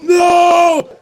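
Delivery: loud, high drawn-out cry; acted horrified shout. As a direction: yell it as she goes over the cliff. Acts man seeing her fall.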